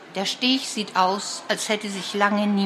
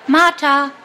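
higher and louder, calling across the yard; 6 semitones higher, 7 dB louder than usual. A narrator's reading. Mats calling Martha from the gate, higher and louder. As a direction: shout her name to fetch her. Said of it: "ruft Mats".

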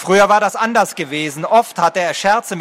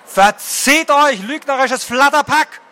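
raised, slow angry shout at the intruders; 8 semitones higher, slower than usual. A narrator's reading. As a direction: yell them off, stretching each word. Villagers chase dragon riders away.

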